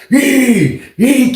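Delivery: two high "yee" squeals; imitated door kicking. Neighbor kicking his bar door, imitated noise. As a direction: squeal out each kick. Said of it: "kicking the shit".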